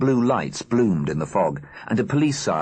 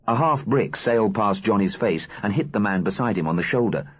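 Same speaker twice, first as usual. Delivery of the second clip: plain reading voice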